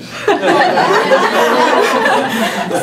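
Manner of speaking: long, high breathy fit of laughter; helpless high giggling; real laughter. Real, not acted. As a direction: crack up at your own punchline. Cracking up at own punchline.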